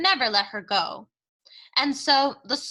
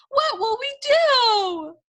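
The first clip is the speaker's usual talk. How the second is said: much higher, louder, slow wail; 14 semitones higher, 5 dB louder, slower than usual. Acted. Cook wailing to Louise in despair, loud and high. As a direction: let the question break into a wail. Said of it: "wailed Cook".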